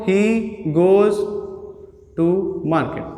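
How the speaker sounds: measured, with pauses between the words; word-by-word example. Shown as a demonstration. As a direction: give each word its own beat. Spelling out example sentence for pupils. (एक-एक शब्द रुक कर बोलते हुए)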